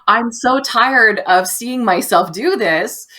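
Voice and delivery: slow, loud, fed up with herself; exasperated outburst. Retold or imitated. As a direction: vent the frustration, drawn out. Frustration at own pattern.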